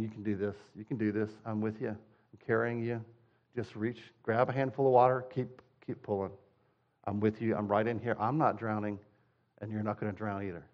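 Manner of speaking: soft, quiet encouragement; reassuring Jesus. Retold or imitated. Jesus beside the swimmer, soft and reassuring. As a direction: gently coax someone who's afraid of sinking. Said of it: "swimming along saying".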